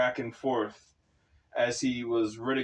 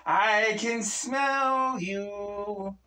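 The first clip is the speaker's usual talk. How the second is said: slow, high, otherworldly hiss; demon voice; 9 semitones higher, much slower than usual. Acted. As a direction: drag it out like a creeping demon. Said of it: "the demon said".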